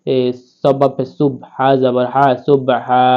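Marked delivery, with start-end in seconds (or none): none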